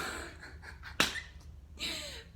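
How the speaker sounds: wheezing giggle; helpless high-pitched laughter; high breathless laugh. Real, not acted. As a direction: silent shaking laugh with a squeak. Cracking up mid-story.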